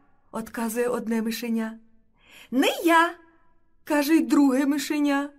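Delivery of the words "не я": high, loud mouse voice; high little protest; refusing little mouse. A narrator's reading. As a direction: pipe up in quick refusal. Second mouse refuses chore.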